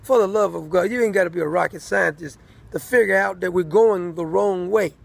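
ordinary talk

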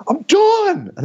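very high, loud squeal; imitating a man storming off; mock fed-up shout. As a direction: shriek it in hammed-up exasperation. Retold outburst by Shep.

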